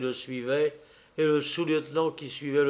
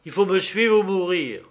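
raised, louder threat; angry outcry; 5 semitones higher, 5 dB louder than usual. A narrator's reading. Prince threatening Pierrot with death, angry outcry. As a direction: threaten in a high, forceful voice. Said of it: "s'écria Horribilis transporté de colère".

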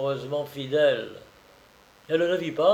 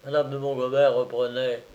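ordinary delivery, nothing marked

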